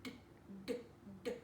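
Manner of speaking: crisp repeated d, d, d; teacher sounding out d. Shown as a demonstration. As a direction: tap out the d sound. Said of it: "I hear".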